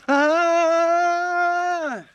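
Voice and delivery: very high, very loud terrified scream; startled scream. Retold or imitated. Stranger ambushed on the trail, very high terrified scream. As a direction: shriek as if ambushed. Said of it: "He's like".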